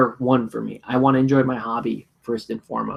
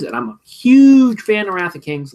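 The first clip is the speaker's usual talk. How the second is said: excited; 8 semitones higher, 6 dB louder than usual. Real, not acted. Gushing about a favourite game.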